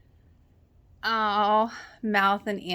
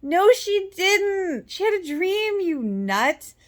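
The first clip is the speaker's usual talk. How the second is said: higher, slowed, exasperated talking back; mock retort; 11 semitones higher, 3 dB louder, slower than usual. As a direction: scold him as if through the screen. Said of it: "It's like".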